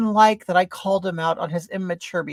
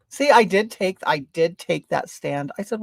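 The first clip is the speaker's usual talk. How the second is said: normal talking voice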